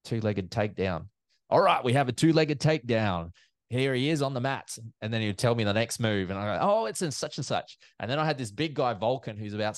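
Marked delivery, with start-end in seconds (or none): 1.5-4.8 higher-pitched live commentary call; event commentator. Retold or imitated. Commentator passing on the expert's tip to the crowd, commentary voice. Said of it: "I was doing the commentary". 6.7-7.8 high-pitched mock commentary line; event commentator. Commentator relaying the next judo move, high commentary voice. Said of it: "I was doing the commentary".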